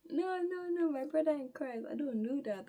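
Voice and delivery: high, loud mock protest; emphatic refusal. Refusing the man's offer of coke, emphatic. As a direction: plead it loudly, settling a little by the end.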